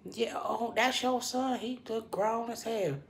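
high, bright put-on interest; fake friendly. Imitating herself faking interest in the boss's baseball talk. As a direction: play along sweetly, faking enthusiasm. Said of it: "I'll be phony".

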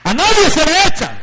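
loud, high preacher's cry; preaching shout. Acted. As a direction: call it out to the whole church. (grito de predicador, agudo y fuerte)

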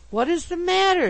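very high, loud query; astonished question. A narrator's reading. Three friends find Pickwick holding a fainted lady, astonished. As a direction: blurt it out, baffled.

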